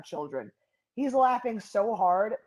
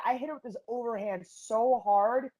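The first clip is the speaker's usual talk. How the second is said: usual speaking voice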